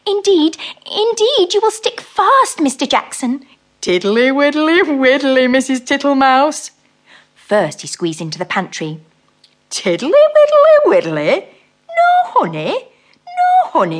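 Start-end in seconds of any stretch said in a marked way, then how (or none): 0.1-3.4 high, loud, fussing mouse warning. Acted. Mrs Tittlemouse warns the toad he will stick, high loud mouse voice. 3.8-6.7 slow, fat, comfortable toad voice; fat-voiced toad. Mr Jackson makes himself at home, slow fat toad voice. 9.7-14.0 high, loud, searching toad voice; disappointed toad. Acted. Mr Jackson searches the pantry for honey, loud disappointed toad voice.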